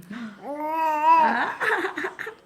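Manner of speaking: bright burst of laughter; laughing. Real, not acted. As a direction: laugh out loud with delight. Baby eating, parent delighted.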